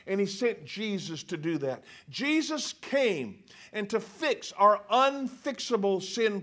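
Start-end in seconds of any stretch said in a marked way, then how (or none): none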